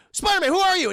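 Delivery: high, loud furious demand; mock angry demand. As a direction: shout at the masked intruder. Harry confronting Spider-Man.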